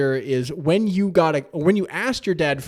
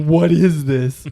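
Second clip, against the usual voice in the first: slow, laughing repeat of the question; laughing echo; 5 dB louder, much slower than usual. Real, not acted. Co-host laughing back the boy's puzzled question. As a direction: chuckle through the words, drawn out. Co-host echoes the story quote.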